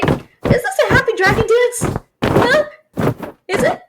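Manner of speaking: high delighted huh; amused disbelief. Acted. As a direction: tease the dancing dragon. Amused at dragon dance.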